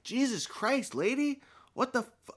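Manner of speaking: high, appalled cry at the nurse; retelling his shocked outburst at the nurse, high and appalled. Retold or imitated. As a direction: blurt it out in disbelief.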